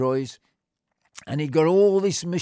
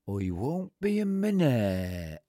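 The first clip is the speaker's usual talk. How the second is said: put-on slow old groundsman; 2 semitones lower, 3 dB quieter, slower than usual.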